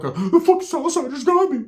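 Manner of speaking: high-pitched mock victim wail; joking fake distress. Injured narrator pranking his cousin, joking fake distress. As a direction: cry it out as a put-on prank. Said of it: "I play a joke".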